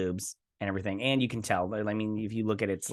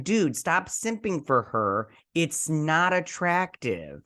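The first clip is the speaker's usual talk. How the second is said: slow, drawn-out, higher-pitched plea; exasperated telling-off; 5 semitones higher, much slower than usual. Retold or imitated. Host tells Jordan to stop simping for her, exasperated. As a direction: stretch each word in mock exasperation.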